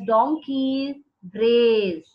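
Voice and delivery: "brays" stretched out slowly; teacher drawing out "donkey brays" for students; slow, drawn-out "donkey brays". Shown as a demonstration. Teacher to students, slowly drawn-out word. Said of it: "that funny noise of the donkey is called as".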